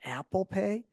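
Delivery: sheepish, hushed question, lifting on the last words; self-mocking silly. Customer timidly asking at the pharmacy counter, silly and hushed. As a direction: softly re-enact your own silly question. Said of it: "I said it super silly".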